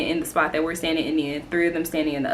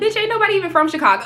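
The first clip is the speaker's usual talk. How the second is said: laughing retort; 11 semitones higher, 3 dB louder, much faster than usual. Retold or imitated. Her retort in the argument.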